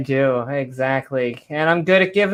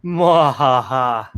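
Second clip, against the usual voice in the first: 1 mock villain laugh; 3 semitones lower, much slower than usual. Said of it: "classic supervillain dilemma".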